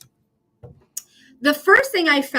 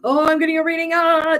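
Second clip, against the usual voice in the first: a little higher, lightly voiced nervous jitter; mock the nervous client getting a reading; 4 semitones higher, 4 dB louder than usual. Reader imitates a nervous client before a tarot reading, a touch jittery. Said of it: "like, a nervous".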